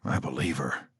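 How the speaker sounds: soft, low whisper. Acted. Jack whispering to Danny, soft and low. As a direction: whisper it aside.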